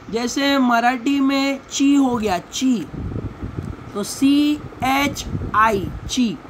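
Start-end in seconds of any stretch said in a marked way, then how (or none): 4.0-5.9 "सी एच आई" slowly, letter by letter; spelling out. Shown as a demonstration. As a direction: spell "सी एच आई" with a gap after each letter. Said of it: "इसको syllable बोलेंगे".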